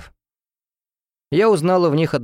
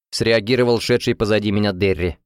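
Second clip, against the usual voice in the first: ordinary delivery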